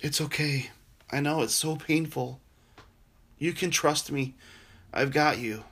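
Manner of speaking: soft, gentle comfort with long pauses; a hushed, steady voice soothing someone in pain; tender reassurance. Acted. Jesus comforting a suffering believer, softly reassuring.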